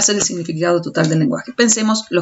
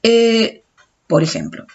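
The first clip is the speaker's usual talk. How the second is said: ordinary talk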